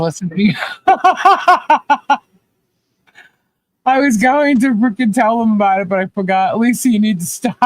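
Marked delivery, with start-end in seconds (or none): 0.6-2.2 high, rapid peals of laughter; big burst of laughter. Real, not acted. Worried someone is listening, big high burst of laughter. Cracking up mid-chat.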